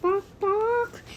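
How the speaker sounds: high clucking barks; imitated chicken. Retelling what the chicken says at the restaurant, high imitated squawks. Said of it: "and the chicken's saying".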